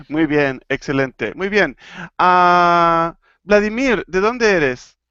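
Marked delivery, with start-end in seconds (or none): none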